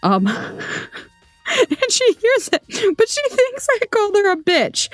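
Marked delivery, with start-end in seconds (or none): 1.5-4.9 high, squeaky with real laughter; cracking up mid-story; laughing while talking. Real, not acted. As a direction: struggle to get it out through giggles.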